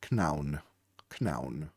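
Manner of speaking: KNOWN with a sounded K, "k-noun"; Old English pronunciation. Shown as a demonstration. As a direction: say KNOWN as "k'nown", rhyming with "town". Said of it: "in Old English".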